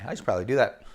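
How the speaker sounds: plain reading voice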